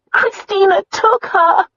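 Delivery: slow, high, crying voice; tearful. A narrator's reading. Sister crying to her brother, high and tearful. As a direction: sob it out slowly.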